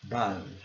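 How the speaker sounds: low "Above", only the bove part as BUV; final syllable. Shown as a demonstration. Teacher demonstrates the syllable, low careful pronunciation. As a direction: isolate the last syllable of "Above", short uh. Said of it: "a sílaba final".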